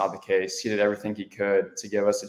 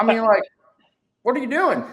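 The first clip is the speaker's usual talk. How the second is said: outraged coach; 14 semitones higher, 5 dB louder than usual. Retold or imitated. Mimicking coach's outraged reaction.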